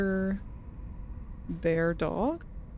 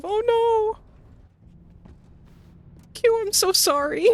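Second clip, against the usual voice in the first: laughing while it hurts, voice jumping high; pained laughter, squeaking out apologies; laughing through pain; 13 semitones higher, 4 dB louder than usual. Real, not acted. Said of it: "it hurts to laugh".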